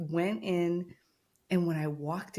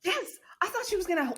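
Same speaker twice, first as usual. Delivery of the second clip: ordinary talk, nothing marked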